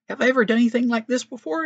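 high, loud and fast mock protest; the donkey snapping back at Balaam, high and quick; indignant donkey.

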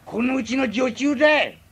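put-on a drunk who is fed up with the maid, loud and sulky; drunk angry outburst. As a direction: blurt the grievance out loud. Drunk complains about the maid.

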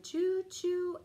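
put-on a playful whistle for the kids; train whistle choo.

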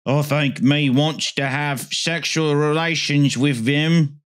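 slow, drawn-out put-on British voice; Savile caricature. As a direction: drag each word out in a broad caricature. Mock Savile quote.

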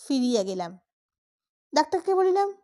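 usual speaking voice

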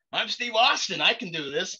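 kid playing superhero. A boy in the yard announces he's Steve Austin, boyishly heroic.

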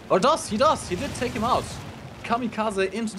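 rising, thrilled play-by-play call; excited surprise. Real, not acted. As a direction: it landed, sell the surprise. Caster sees a surprise kill.

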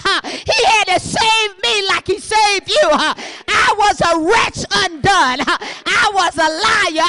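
loud shouted testimony, huffing laughs between lines; full-force preaching with laughs. Acted. Preacher confessing her past sins to the church, shouted with laughter.

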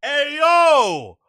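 high, loud alarmed outcry; loud startled shout. Real, not acted. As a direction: shout to stop him. Stopping the crude threat.

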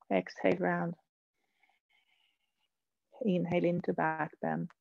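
soft, hushed breathing instructions with long pauses; soft-voiced yoga teacher pacing the breath; yoga breath cues. Real, not acted. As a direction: quietly guide the breath, leaving space between cues. Yoga breath cues led softly.

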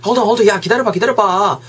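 urgent protest. Acted. Friend stopping the other from switching channels, urgent protest. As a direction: cut in to stop a friend.

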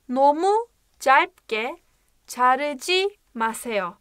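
Nomu calpge drawn out very slowly, syllable by syllable; slow model reading. Shown as a demonstration. Teacher showing how the phrase is read. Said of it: "Nasıl okunuyormuş?".

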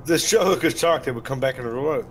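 ordinary talk

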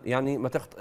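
ordinary talk, nothing marked

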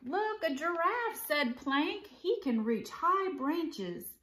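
animated picture-book read-aloud; story narration. A narrator's reading. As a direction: read it to children with clear, lively phrasing.

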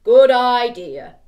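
slow, loud, booming big skeleton. Acted. Storyteller to children, as the big skeleton, slow and loud.

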